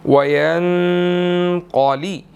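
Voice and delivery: slow "वयनक़ली" with hidden nasal n; "वयनक़ली" drawn out, nasal; ikhfa on the nun. Shown as a demonstration. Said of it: "नून यहाँ पर साकिन है, यहाँ पर इख्फा होगा".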